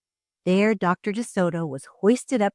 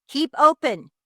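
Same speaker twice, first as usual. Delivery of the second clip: higher, louder yelled order; yelling; 9 semitones higher, 7 dB louder than usual. A narrator's reading. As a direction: yell it up into the jaws. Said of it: "yelled Doctor DeSoto".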